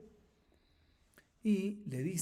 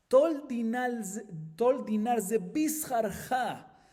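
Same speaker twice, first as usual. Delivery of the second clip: slow, drawn-out Aramaic words, a light quote; slow quoted Aramaic; 4 semitones higher, much slower than usual. Retold or imitated. Teacher quoting the ferryman's offer to the class, slowly. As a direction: lay out each Aramaic word slowly, like a teacher dictating.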